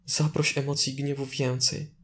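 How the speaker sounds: ordinary talk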